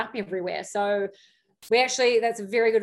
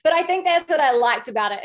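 normal talking voice

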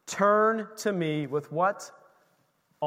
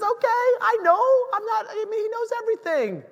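lightly voiced God, amused; 7 semitones higher than usual. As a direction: play God gently teasing. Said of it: "He says".

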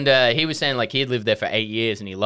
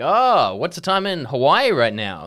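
higher, lilting voice of nosy callers; chirpy callers; 6 semitones higher than usual. Retold or imitated. People calling him at work about Hawaii, mock chirpy. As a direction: put on eager small talk, pitched up. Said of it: "people always call me at work and they're like".

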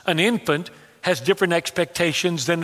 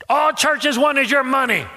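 higher, whiny mock of a church critic; mocking complainer; 6 semitones higher than usual. Pastor mocking people who say churches want money, mock whine. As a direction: sneer it like someone who thinks churches just want cash. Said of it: "when people go".

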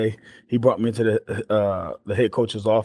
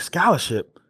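tag; 6 semitones higher than usual. Retold or imitated. Retold teammate scoffs at news.

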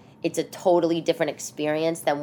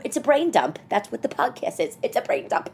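a theatrical, pitched-up brush-off to a friend; mock indignant retort; 9 semitones higher than usual. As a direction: toss it back in an over-the-top high voice. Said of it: "Why do you talk like this on your podcast?".

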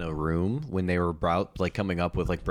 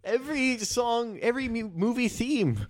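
excited. Real, not acted. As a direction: savour each word, thrilled. Excited discovery.

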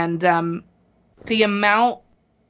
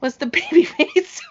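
laughing; 6 dB louder, slower than usual. Real, not acted. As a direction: laugh through it, louder and slower. Laughs at the wrestler's good-guy past.

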